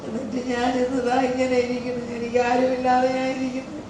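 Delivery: real soft, tearful lament. Real, not acted. Grandmother saying she is left with nobody, softly tearful. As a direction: let it out softly through tears. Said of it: "കരയല്ലേ അമ്മമ്മ".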